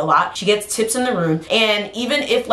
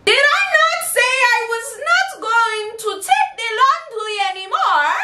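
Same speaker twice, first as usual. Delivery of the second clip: indignant mom; 14 semitones higher than usual. Retold or imitated. Mom voiced, indignant, high.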